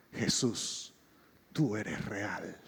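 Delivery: whispered, slow, overwhelmed; awed whisper. Retold or imitated. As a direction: breathe it out in awe, barely voiced.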